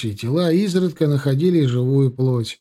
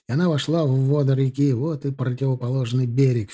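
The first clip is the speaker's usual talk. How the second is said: plain reading voice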